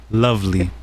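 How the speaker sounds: sarcastic. Retold or imitated. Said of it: "She sarcastically answers".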